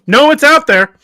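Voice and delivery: high, loud imitated announcement; emphatic mock shout. Imitating friend back from checking Australia exists, emphatic shout. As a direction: call it out as the friend, utterly certain.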